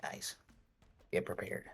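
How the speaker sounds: hushed, urgent whisper. Acted. Todd warning the party they're ahead, whispering. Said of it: "Todd whispers".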